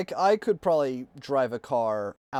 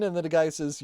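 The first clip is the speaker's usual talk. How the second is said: normal talking voice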